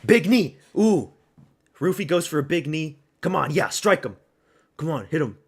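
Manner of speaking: loud, high cheering at a knee; excited shouting. Real, not acted. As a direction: yell encouragement at the screen. Cheering a fight live.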